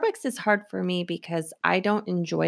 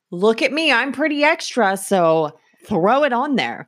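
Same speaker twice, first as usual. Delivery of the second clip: louder, sassy show-off voice; sassy mock retort; 4 semitones higher, 5 dB louder than usual. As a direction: brag that you're worth the extra.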